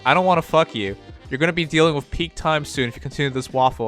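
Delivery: ordinary talk, nothing marked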